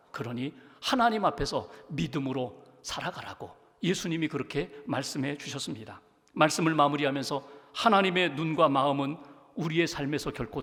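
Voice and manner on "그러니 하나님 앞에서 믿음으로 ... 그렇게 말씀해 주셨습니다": usual speaking voice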